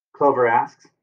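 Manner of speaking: usual speaking voice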